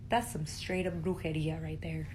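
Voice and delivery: hushed whisper, no voice. Acted. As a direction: breathe it like a secret. Conspiratorial aside about brujeria.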